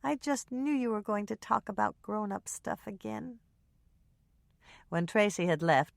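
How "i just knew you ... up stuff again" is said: put-on soft, higher little girl's complaint; mildly resigned child. A narrator's reading. Daughter complaining about grown-up talk, lightly sulky child voice. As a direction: grumble softly like a bored child. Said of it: "with a resigned sigh".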